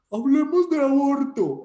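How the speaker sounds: loud, high, drawn-out mock proclamation; hammed-up high, slow debate call; mock philosophy debater. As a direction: parody someone grandly opening a debate. Mocks solemn philosophy debaters.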